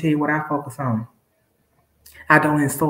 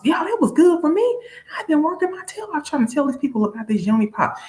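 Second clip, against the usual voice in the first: much higher, passionate telling; excited; 13 semitones higher than usual. Real, not acted. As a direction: gush about what changed your life. Passionate about the product.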